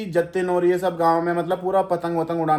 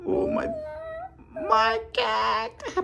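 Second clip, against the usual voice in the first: very high doting coo; 5 dB quieter, much slower than usual. Real, not acted. Cooing at toddler.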